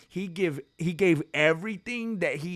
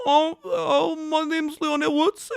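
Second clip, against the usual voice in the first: high British accent with a hammed-up sob; a British fighter fake-crying at the mirror; mock weepy British accent; 11 semitones higher, 4 dB louder than usual. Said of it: "I don't know how to do a British accent".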